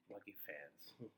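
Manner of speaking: breathy whisper with a laugh; slow, laughing, breathy whisper. Real, not acted. Savoring the memory, whispered.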